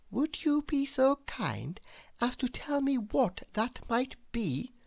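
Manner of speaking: high, soft and courteous; polite question. A narrator's reading. Mister Perfect calmly answering the rude guest. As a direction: ask sweetly, unruffled. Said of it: "asked Mister Perfect".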